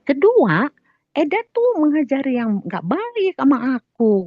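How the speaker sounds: high re-enacted reproach; admonishing. Teller lists in-law's second wrong, admonishing.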